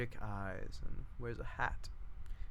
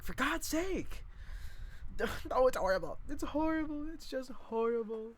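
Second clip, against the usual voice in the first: hammed-up dismay; 12 semitones higher than usual. [name] lamenting the news, hammed-up high wail. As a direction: overplay the horror.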